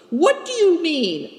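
high, demanding question; brusque. A narrator's reading. Leader pressing his companion to explain, brusquely. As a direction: demand an explanation, pitch jumping up. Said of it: "asked Good Fortune brusquely".

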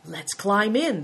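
usual speaking voice

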